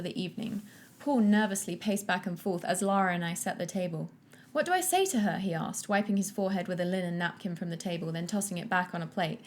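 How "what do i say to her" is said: nervous. Acted. Nervous Paul asks for help.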